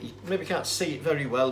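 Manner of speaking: ordinary talk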